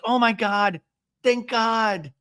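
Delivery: high mock relief; Shannon. Recapper mimics housewife after relief, high mock gratitude. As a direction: sigh thanks in a high, put-on voice. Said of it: "And then Shannon goes".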